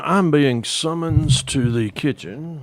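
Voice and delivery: slow, stately sign-off, starting high; mock-formal announcement. Guest leaving the call for the kitchen, playfully grand. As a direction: announce it grandly, drawing out the words.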